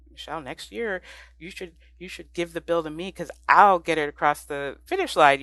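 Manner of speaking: retold eager pitch, a touch animated; mildly excited. Legislator offering to carry the bill next year, mildly excited. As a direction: lightly imitate a keen volunteer building in emphasis. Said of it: "so excited about this".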